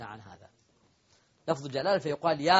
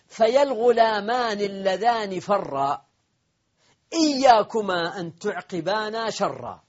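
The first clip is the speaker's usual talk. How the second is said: slow, higher and louder declaimed verse; poem recitation; 3 semitones higher, 4 dB louder, slower than usual. Shown as a demonstration. Teacher declaiming the poet's verse, slow and lifted. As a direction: lift each word of the couplet slowly. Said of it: "ومنه قول الشاعر".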